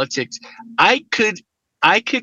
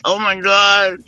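higher-pitched, drawn-out exasperation; mock dismay; 5 semitones higher, 4 dB louder, slower than usual. Viewer reacting to cooks' advice on chitlins, mock dismay. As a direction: wail it in comic disbelief. Retells horror at contestants' cooking advice.

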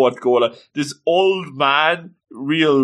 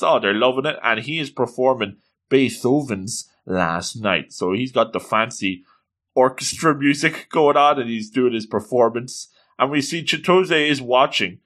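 ordinary talk, nothing marked

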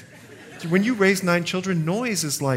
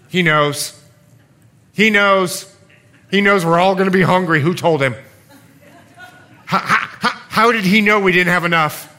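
louder, flustered disciples, a touch of laughter at the end; worried disciples; 4 semitones higher, 7 dB louder than usual. Acted. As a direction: play the panicked fishermen fretting over bread. Disciples panicking about bread, acted.

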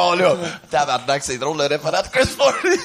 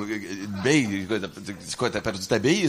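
confused mumble; 10 semitones lower, 6 dB quieter than usual. Retold or imitated. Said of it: "Moi, je suis comme".

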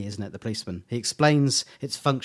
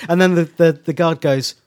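ordinary delivery

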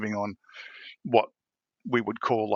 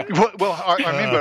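real laugh breaking into words; high laughing burst; 9 semitones higher, 7 dB louder than usual. Real, not acted. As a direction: laugh loud, then try to talk.

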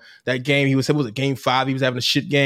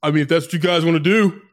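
Budenholzer impression; 3 semitones higher, 3 dB louder than usual. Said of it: "Budenholzer like".

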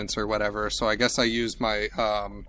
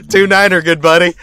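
put-on trucker CB accent; 8 semitones higher, 15 dB louder than usual. Falk signs off to HQ in a hammed-up trucker voice. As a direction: ham it up like a CB trucker. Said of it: "You got me just copying yours".

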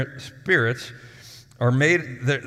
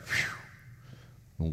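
soft exhale; 6 semitones lower, 12 dB quieter than usual. Acted. Wisdom falling on the room.

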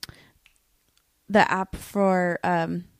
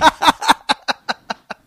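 high, loud villain cackle; evil maniacal laugh; 7 semitones higher, 8 dB louder than usual. Pretend. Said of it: "There's your maniacal laugh".

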